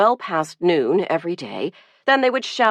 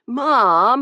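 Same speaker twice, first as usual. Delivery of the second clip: a louder, higher protesting wail; 6 semitones higher, 5 dB louder than usual. A narrator's reading. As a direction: whine the word out like a sulky teen. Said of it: "he wailed".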